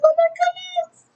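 very high, squeaky excitement; gushing. Retold or imitated. Fan reacts as characters bump into each other, gushing.

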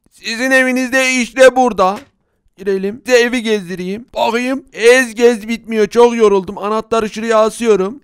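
ordinary talk, nothing marked

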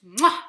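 big smacking air kiss; loud kiss sound. Acted. Rhyme leader blowing the children a loud smacking kiss. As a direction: blow a loud kiss to the kids.

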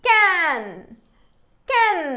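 "క్యాన్" high and loud, falling; pronunciation demo. Shown as a demonstration. Teacher modelling how it is read, "క్యాన్" high and falling. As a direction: sound "క్యాన్" as one blended word, high then falling. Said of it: "క్యాన్గా పలుకుతుంది".

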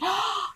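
sharp, high gasp of delight; excited gasp. Real, not acted. Seeing what her friend sent, excited gasp. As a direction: gasp in happy surprise. Sees the handmade gift.

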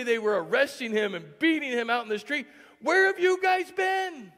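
acted-out exasperation, mounting to a holler; angry outburst. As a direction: protest indignantly, ending in a yell. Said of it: "could have been one of anger".

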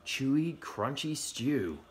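plain reading voice

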